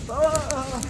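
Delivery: sudden high yelp; startled yelp. Real, not acted. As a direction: cry out as you slip. Falls in the swamp.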